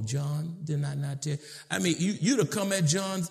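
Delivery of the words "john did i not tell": low, quiet parental correction; restrained, softly spoken reprimand; kind, soft voice. Acted. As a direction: drop low and soft, meaning business. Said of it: "you just talk normal".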